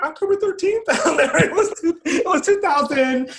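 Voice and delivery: laughing while remembering. Real, not acted. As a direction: giggle through the date. Laughing retelling.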